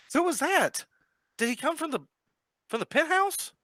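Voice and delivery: high, worried questioning; anxious cop. Acted. Callahan asking the players where the body came from, high and worried. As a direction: press them for answers, rattled.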